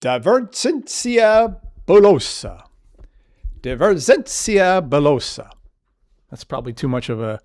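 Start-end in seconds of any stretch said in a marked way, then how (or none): 0.0-2.6 "divergentia bullosa" in a mock Italian accent, high and slow, rolling the vowels; hammed-up Italian-Latin, drawn out; bad Italian accent. Teacher jokingly gives the Latin term, hammed-up Italian accent. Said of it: "bad Italian accent". 3.6-5.5 "Divergentia bullosa" repeated in a mock Italian accent, slow and sing-song; hammed-up Italian flourish, slightly calmer; bad Italian accent. Teacher repeats the Latin term, mock Italian accent. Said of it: "bad Italian accent".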